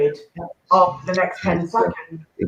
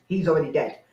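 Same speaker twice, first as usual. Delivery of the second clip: ordinary delivery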